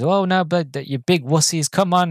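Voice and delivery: lightly voiced pilot scolding the cowards; mock exasperated taunt. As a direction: needle them, fed up. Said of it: "he goes".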